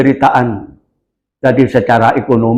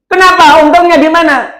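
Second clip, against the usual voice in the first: indignant question; 4 dB louder than usual. Retold or imitated. Woman protests to the neighbor.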